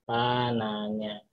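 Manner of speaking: slow, soft teacher emphasis; drawn out. Shown as a demonstration. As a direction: draw out pananya for the class. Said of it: "pananya ya".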